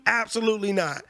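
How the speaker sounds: ordinary delivery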